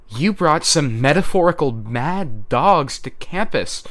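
slow, high, loud accusation; calling out. A narrator's reading. Verona accusing Bristow across the woods, calling out. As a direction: shout it out slowly so all can hear. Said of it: "Verona called out".

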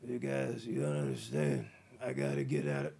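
deep, low mumble as a weak, groggy Seagal; Seagal impression. Seagal just out of a coma pleading with doctors, deep groggy mumble. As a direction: drop way down and drag it out like you just woke from a coma. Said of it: "And he's like".

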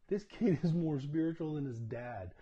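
loud, high, laughing exclamation. Real, not acted. Dad marvelling at his son's wisdom, laughing loudly. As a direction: delight breaking into laughter.

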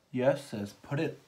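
loud, slow yes sis, put it on; hype cheer. Real, not acted. Hyped cheering at the lipstick.

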